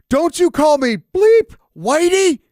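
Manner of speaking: high, loud, slow outraged shout; shocked yell. Retold or imitated. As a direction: spit it out in horror. Intern relays the offensive lyric.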